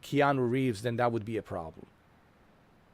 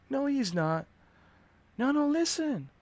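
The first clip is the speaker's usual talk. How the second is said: put-on higher, pleading girlfriend voice; girlfriend falsetto. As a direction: beg him to listen, high and eager. Girlfriend mimicked.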